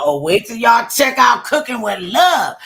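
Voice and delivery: loud, high excited boast; excited show plug. Retold or imitated. Host hyping her cooking show to shoppers, excited. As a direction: shout it like a big promo.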